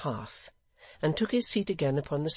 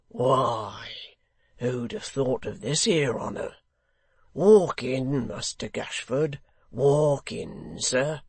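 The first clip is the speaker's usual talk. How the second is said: hearty, surprised welcome; evident surprise; 5 dB louder, slower than usual. A narrator's reading. Man at the door inviting the secretary in, surprised. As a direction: welcome an unexpected guest in. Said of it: "in evident surprise".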